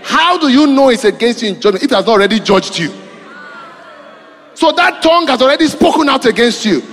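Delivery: fiery preaching. Acted. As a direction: preach it out to the congregation. Preaching at full force.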